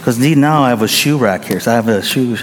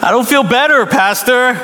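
a higher, lightly whiny plea; complaining member; 9 semitones higher than usual. Retold or imitated. Member tells the pastor he still feels bad, higher and whiny. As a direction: let it sag into a complaint.

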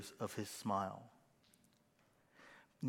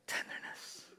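whispered word; 9 dB quieter than usual. Shown as a demonstration. Pastor whispers his calm word before a fight. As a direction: breathe the word out gently to calm yourself.

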